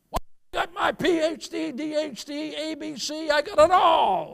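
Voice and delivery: high, loud bragging voice; crowing about degrees; boastful big shot. Acted. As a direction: puff up like a proud know-it-all. Mocking a boastful educated man.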